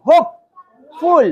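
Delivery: "হোপফুল" pulled apart for spelling; syllable by syllable. Shown as a demonstration. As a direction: say "হোপ", long pause, then "ফুল". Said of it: "এভাবে কি বানান ভুল হবে?".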